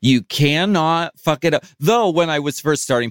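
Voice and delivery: usual speaking voice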